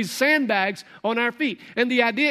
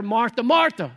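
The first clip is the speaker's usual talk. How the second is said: mild mock yell; 4 dB louder than usual. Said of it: "I think he had to yell at her".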